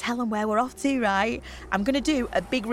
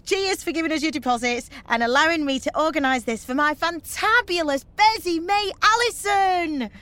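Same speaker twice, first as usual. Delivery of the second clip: raised voice building to a cheer; excited tribute; 4 semitones higher than usual. Acted. Organiser toasting best mate to the bus, excited.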